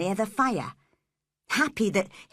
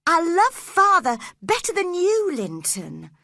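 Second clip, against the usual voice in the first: put-on upset girl; 10 semitones higher, 6 dB louder than usual. A narrator's reading. Cathy, high girl voice.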